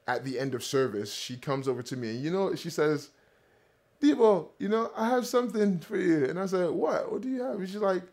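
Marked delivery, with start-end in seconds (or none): 4.0-6.3 mother's voice, lightly Jamaican, raised; imitating his Jamaican mother, pitched up; Jamaican accent. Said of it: "caught the accent that I'm trying to replicate here". 6.7-7.6 surprised. Retold or imitated. Retold reply to mother.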